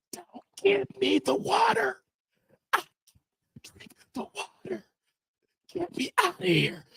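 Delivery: weak breathy plea; barely voiced, faint and high. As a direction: plead faintly as if collapsing. Weak pleading while near collapse.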